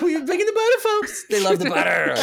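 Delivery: put-on high old lady voice. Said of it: "situation like that".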